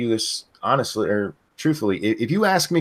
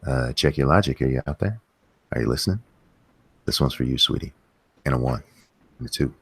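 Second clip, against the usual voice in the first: much lower, sultry crooning voice; dirty voice; 10 semitones lower than usual. Pretend. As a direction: croon it low like a seductive lounge singer. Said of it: "let me get my dirty voice on".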